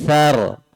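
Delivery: "thar" with the tongue between the teeth; the correct lisped "th" in "thar"; right tha sound. Shown as a demonstration. Teacher giving the correct sound after the wrong ones.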